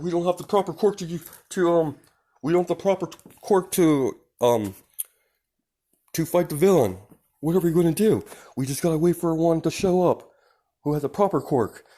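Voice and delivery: high, loud voice of flustered heroes; helpless heroes. Acted. Heroes unable to fight the villain, loud and high helplessness.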